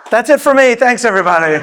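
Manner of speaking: loud, high joking goodbye; mock farewell. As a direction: wrap it up like a showman. Joking exit after the accent.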